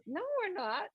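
mock high protest, a touch of a voice; high, quick little no from the brain; voicing the stubborn brain; brain's voice. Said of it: "their brain goes".

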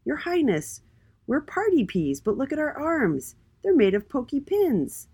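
high-pitched glum little character; high, mildly downcast plea. A narrator's reading. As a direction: complain glumly in a high voice. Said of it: "The first pea said glumly".

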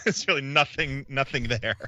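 ordinary talk, nothing marked

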